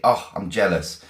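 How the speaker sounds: normal talking voice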